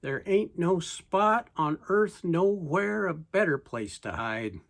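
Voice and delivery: plain reading voice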